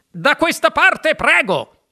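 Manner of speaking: high, loud, slow direction; calling out. A narrator's reading. Wonka directing visitors at the stairs, high drawn-out call. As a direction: call the stragglers to follow you. Wonka calls the group along.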